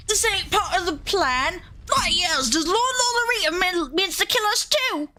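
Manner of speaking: high-pitched, indignant goon voice; outraged goon. Acted. Thug realising his lord means to kill him, high indignant voice. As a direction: squawk it in high, outraged disbelief.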